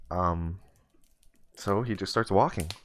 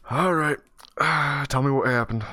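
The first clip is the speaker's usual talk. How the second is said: weary judge; 6 semitones higher, 5 dB louder than usual. Acted. Judge voiced in the story.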